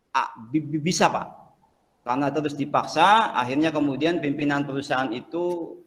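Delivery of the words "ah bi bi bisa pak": stammering, syllables repeated haltingly; stuttering job seeker. Acted. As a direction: stutter through every word like a nervous applicant. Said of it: "tahu ya orang gagap ya?".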